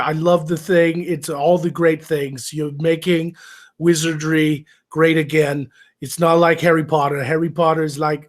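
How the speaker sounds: hammy Trump flattery; Trump impression. Host imagines Trump praising him as a wizard, hammy impression. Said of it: "a great Trump impression just so I could be like".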